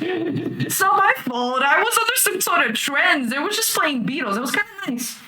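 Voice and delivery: put-on a young woman pleading innocence; defensive girl voice. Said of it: "Lily is back to her normal self and is like".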